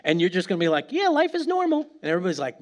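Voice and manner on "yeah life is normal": nonchalant. Pretend. Nonchalant believer brushing it off.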